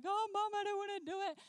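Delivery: high, quick mock whine; imitated crying child. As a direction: whine like a little girl on the verge of tears. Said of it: "she's crying, and she's like".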